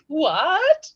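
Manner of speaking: high, rising mock bafflement; clueless aunt's "what?". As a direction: imitate a clueless aunt, pitch jumping up. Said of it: "And she's like".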